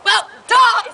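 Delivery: normal talking voice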